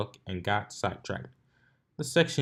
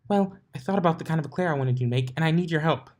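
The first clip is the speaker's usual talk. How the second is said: higher, fast bubbly chatter; excited Pinkie; 7 semitones higher, 3 dB louder, faster than usual. A narrator's reading. Pinkie excitedly asking Twilight for help. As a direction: bubble over with the idea.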